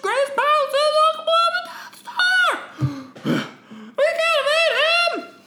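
high, frantic alarm; panicked pilot. Acted. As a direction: cry out in panic at the attack. Voicing panicked Jedi pilot.

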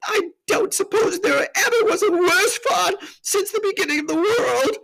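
high, wailing self-reproach; tearful, hammed-up high voice; sobbing. A narrator's reading. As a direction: bawl it out like a miserable faun. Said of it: "replied Mister Tumnus between his sobs".

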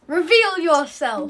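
slow, loud command; mock-grand order, projected; telepathic command. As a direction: draw it out like a booming summons. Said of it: "I'm gonna say".

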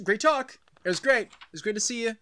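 mock polite escape from mom; awkward brush-off. Acting Dream's awkward goodbye to Mom.

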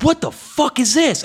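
high, loud shocked cry. Retold or imitated. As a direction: yelp of disbelief. Kid shocked at screen.

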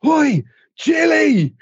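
loud high shout across the hall; hollered name, high and slow; calling out. Retold or imitated. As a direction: yell to get her attention.

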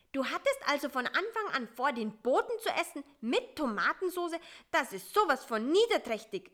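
high, loud, hurried outrage; scolding. A narrator's reading. Fly discovers spider's plan to eat the messenger, scolding. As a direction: tell him off in a high, indignant rush. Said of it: "schimpfte Bissi".

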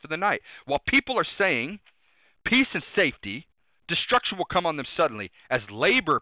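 loud, ringing pulpit preaching; full-throated sermon voice; preaching at full force. Acted. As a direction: drive the scripture home to the congregation.

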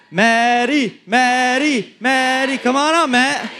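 high, sing-out calling of a nickname; playful high-pitched beckoning; teasing coaxing. Real, not acted. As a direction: coax a reluctant friend up, pitched high. Coaxing scared man onto the stage.

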